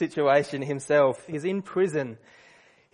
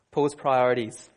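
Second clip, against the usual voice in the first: ordinary delivery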